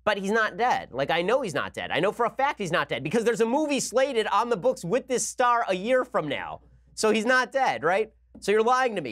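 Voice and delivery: mock-outraged viewer refusing to be fooled; exasperated disbelief. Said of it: "And you're like".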